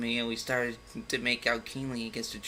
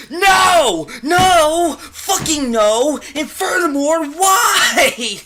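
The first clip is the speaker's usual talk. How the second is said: high, loud screaming no; screamed outrage; outraged shout; 14 semitones higher, 14 dB louder, much slower than usual. Real, not acted. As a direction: scream in real disbelief. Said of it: "reader's own outraged reaction".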